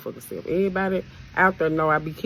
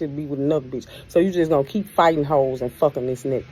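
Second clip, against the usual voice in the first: ordinary delivery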